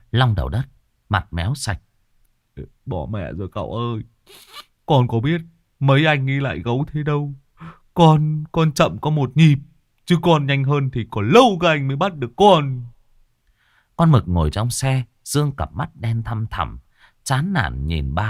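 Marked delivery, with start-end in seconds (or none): 2.9-12.8 louder, rising protest; whiny excuse. Acted. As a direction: defend yourself sheepishly. Driver excuses himself. (giọng thanh minh)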